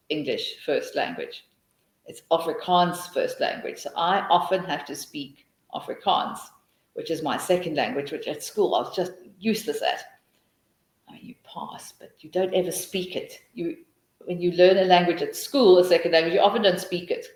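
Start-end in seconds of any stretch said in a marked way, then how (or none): none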